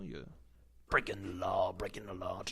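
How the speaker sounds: whispered "breaking the law" twice; whispering. Acted. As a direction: breathe the line as a whisper. Whispered song quote.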